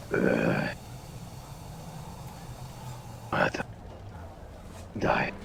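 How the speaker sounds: very low, groaning mumble; slowly waking up. Acted. Bandit coming round in the corner, groggily waking up. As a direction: groan out broken words, barely conscious. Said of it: "He's just slowly waking up".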